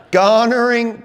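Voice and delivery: "Garnering" pitched high, loud and stretched out; emphatic correction. Shown as a demonstration. Correcting a misheard word.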